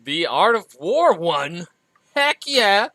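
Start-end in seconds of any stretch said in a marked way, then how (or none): 2.2-2.9 high, loud little cheer; excited cheer. Real, not acted. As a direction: punch it out like a victory shout. Celebrating their team's win.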